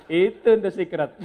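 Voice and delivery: loud, slow words through laughter; laughing. Real, not acted. Speaker revealing his marriage secret to the audience, laughing loudly. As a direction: announce the secret while cracking up. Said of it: "kenapa saya ketawa terus".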